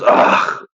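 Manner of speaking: imitated fed-up groan; mock exasperated "ugh". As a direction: groan like a put-upon doctor. Retold doctor's exasperated groan.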